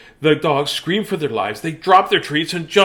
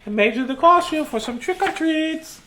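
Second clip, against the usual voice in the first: doting mother; 13 semitones higher than usual. A narrator's reading. Mother voiced in story.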